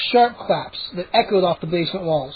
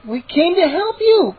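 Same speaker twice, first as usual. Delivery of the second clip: higher, slow, pleading scream; desperate scream; 10 semitones higher than usual. Acted. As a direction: scream the plea over the noise. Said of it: "I heard Mindy scream".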